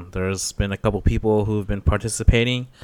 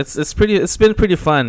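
laughing; 9 semitones higher, 5 dB louder, faster than usual. Real, not acted. Laughing high voice.

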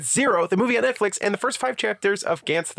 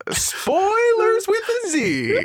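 playful; 3 dB louder, slower than usual. Acted. Joking rename.

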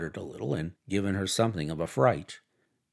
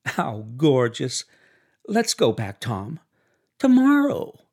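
a wife begging her husband to go back; excited Daisy; 2 semitones higher, 6 dB louder than usual. A narrator's reading.

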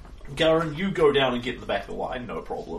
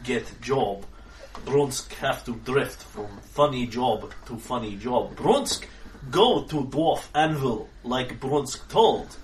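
very slow, plodding broken English; slow simple brute; slower than usual. Acted. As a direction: speak like a dim, gentle giant. GM voicing Brunsk, slow.

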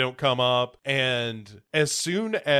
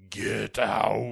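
famous get out. Retold or imitated. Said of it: "the famous".

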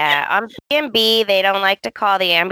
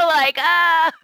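mock wail; 8 semitones higher than usual. Mock cry of mixed feelings.

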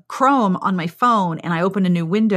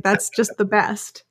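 ordinary delivery

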